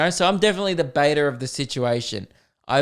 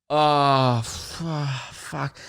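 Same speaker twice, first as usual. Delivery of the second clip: exasperated sigh; much slower than usual. Retold or imitated. Car owner seeing his wrecked boot, imitated dismayed sigh. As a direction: let the curse deflate out on a heavy breath. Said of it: "he just goes".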